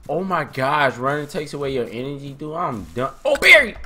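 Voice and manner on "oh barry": high, loud startled outburst; sudden high exclamation; startled cry. Real, not acted. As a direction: yelp it out in surprise. Startled while playing a game.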